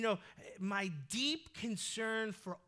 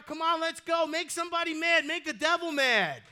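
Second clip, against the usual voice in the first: fired-up preaching; 7 semitones higher, 10 dB louder than usual. Real, not acted. Preacher rallying the congregation.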